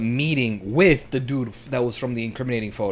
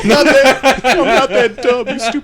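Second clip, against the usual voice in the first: much higher, louder mocking voice; mock Victor; 12 semitones higher, 10 dB louder than usual. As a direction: ham up the refusal in a squeaky voice.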